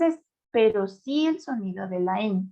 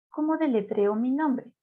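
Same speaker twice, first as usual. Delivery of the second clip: ordinary delivery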